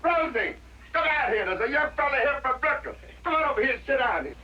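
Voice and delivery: high pitched call into the house; husband calls his wife out to meet the young visitor, high calling voice; calling out to Rosie. Acted.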